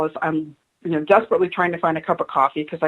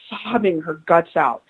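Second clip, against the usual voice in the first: usual speaking voice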